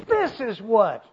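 "this is what" starting high, falling sharply; emphatic phrase. Acted. Preacher repeating Paul's emphatic phrase, stressed. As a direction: strike "this is what" with force. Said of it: "that's, that's an emphatic phrase".

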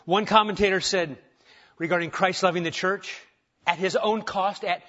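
ordinary talk, nothing marked

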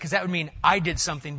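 ordinary talk, nothing marked

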